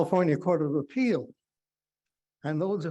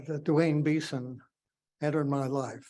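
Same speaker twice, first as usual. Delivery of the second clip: ordinary talk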